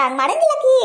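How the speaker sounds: high, loud, laughing protest; words bubbling up in a laugh. Acted. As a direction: laugh it out in mock denial. Mother laughs defending her daughter.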